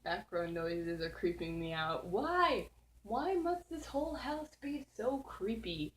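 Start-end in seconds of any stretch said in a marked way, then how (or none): 2.1-5.9 higher mock-despairing plea; dramatic whine. Real, not acted. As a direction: complain to the house, hammed-up. Spooked by background noises.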